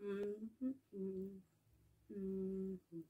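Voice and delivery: soft contented hums through the food; humming while eating. Shown as a demonstration. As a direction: hum happily with a mouthful. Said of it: "I always go like this way".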